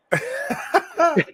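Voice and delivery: high, breathy burst of laughter; real burst of laughter. Real, not acted. Host laughing at being hung up on, high laugh.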